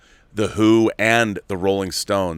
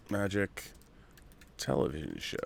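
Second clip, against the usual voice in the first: much softer, half under the breath; muttered to self; 15 dB quieter than usual. Real, not acted. Host looking up a show to himself, muttered. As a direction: murmur it while looking something up.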